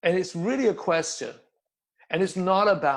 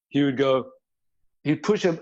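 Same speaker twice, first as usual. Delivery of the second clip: ordinary talk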